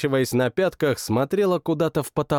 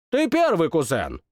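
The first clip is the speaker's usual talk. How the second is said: ordering king; 3 semitones higher than usual. Acted. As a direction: order him to go first. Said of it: "распорядился король".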